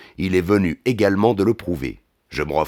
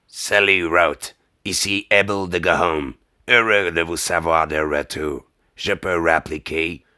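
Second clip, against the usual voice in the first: put-on Cockney-accented caller on the line; 3 semitones lower than usual. A narrator's reading. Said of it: "Une voix à l'accent cockney lance". (accent cockney en français)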